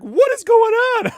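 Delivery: put-on shrill-high bewildered kid voice; baffled. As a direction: squeak it out in total confusion. Uncles confused as kids.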